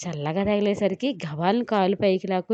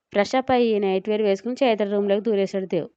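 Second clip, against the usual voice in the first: plain reading voice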